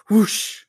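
loud, airy whoosh noise; spoken sound effect of a whoosh; imitated whoosh. Shown as a demonstration. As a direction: make a sudden rush-of-wind whoosh. Imitates storm suddenly stopping.

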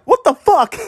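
a fan's stunned cry; stunned disbelief. Retold or imitated. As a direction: replay the shock of seeing the play. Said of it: "That's what I pretty much said".

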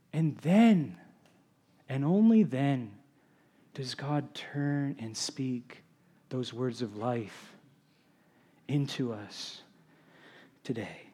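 slow, fading almost away; hushed solemnity. Real, not acted. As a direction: let each phrase sink softly, with long pauses. Solemn climax of preaching point.

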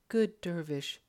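polite. A narrator's reading. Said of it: "she said politely".

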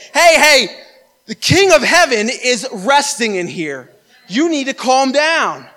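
loud, high call, then slow firm order; commanding rebuke. Acted. Believer to the storms of the world, commanding rebuke.

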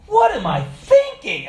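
high, loud, slow self-reproach; shocked at himself. Retold or imitated. Man catching his own stray thoughts, shocked exclamation. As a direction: exclaim it with a laughing disbelief.

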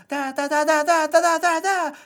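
high, wordless babbling imitation of a confused grandma. Retelling a visit, mimicking his girlfriend's grandma's high babble. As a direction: babble it like she can't find words. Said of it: "she looks at you and goes".

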